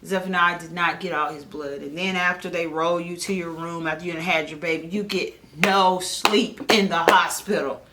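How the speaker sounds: ordinary talk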